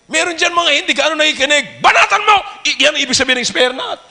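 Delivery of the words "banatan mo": high, urgent preacher's order; shouted command. Real, not acted. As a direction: fire it out as a command. Preacher urging attack on inattention.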